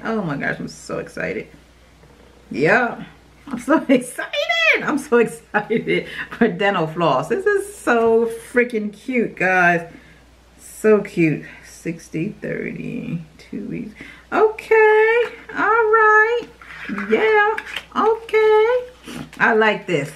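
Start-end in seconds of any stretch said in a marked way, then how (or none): 0.6-1.5 breathy whisper of glee; whispered excitement. Real, not acted. 14.3-19.3 excited squeal. Real, not acted. Excited reaction to floss.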